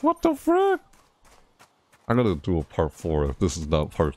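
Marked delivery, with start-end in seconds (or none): 0.0-0.8 high shocked cry; startled outburst. Real, not acted. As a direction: blurt it out in shock. Startled by game.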